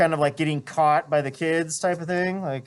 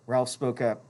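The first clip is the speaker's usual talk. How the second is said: ordinary delivery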